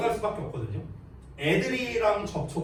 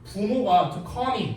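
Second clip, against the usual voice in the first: slow English reading; 3 semitones higher, much slower than usual. Shown as a demonstration. Reading the English passage aloud.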